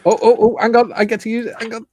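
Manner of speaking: can't wait to press the button; real excited yelps. Real, not acted. Eager to trigger the alert.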